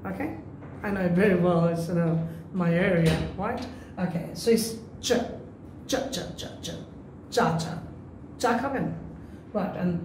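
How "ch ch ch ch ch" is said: soft, unvoiced "ch, ch" puffs; whispered "ch". Shown as a demonstration. Teacher demonstrating the "ch" sound for students. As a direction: hiss out the "ch" sound. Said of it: "this one is cha".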